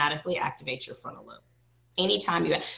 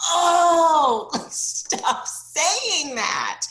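exasperated protest; 10 semitones higher, 6 dB louder, much slower than usual. Retold or imitated. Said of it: "I'm just always like".

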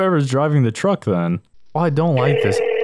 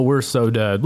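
usual speaking voice

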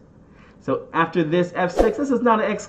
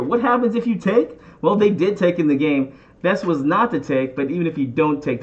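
ordinary delivery

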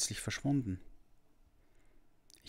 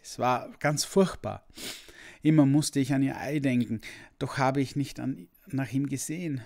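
higher, louder tearful no; sniffling; 6 semitones higher, 5 dB louder than usual. Acted. The boy answers the dragon sniffling and tearful. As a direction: blurt it out while sniffling. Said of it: "schniefte ich".

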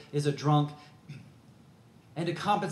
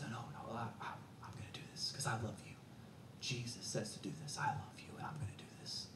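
soft, breathy whisper, much softer; tender whisper; 6 semitones lower, 17 dB quieter than usual. Retold or imitated. Pastor whispering to young men while washing their feet. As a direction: hush it, close and loving.

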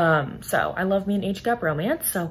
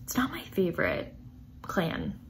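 whisper; 7 dB quieter than usual. Real, not acted. Whispered aside about the clan.

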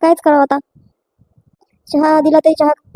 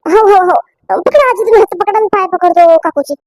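usual speaking voice

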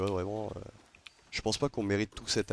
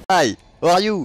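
higher and much louder, a hammed-up French accent; loud French-accented call; 8 semitones higher, 12 dB louder than usual. Streamer begging a Reaper crew for an alliance, loud French-accented shouting. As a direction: shout across the sea like an over-eager French pirate. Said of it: "j'en joue gavé du vieil accent franchouillard".

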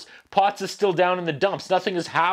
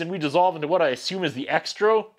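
ordinary delivery

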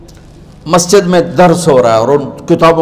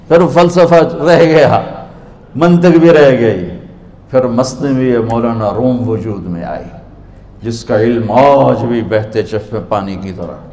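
usual speaking voice

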